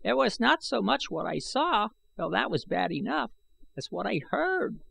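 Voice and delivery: put-on high, thin voice of a scheming old man; sly old man. A narrator's reading. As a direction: let the old man slip in the treason. Old man tells of horrors.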